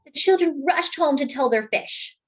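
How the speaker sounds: ordinary delivery, nothing marked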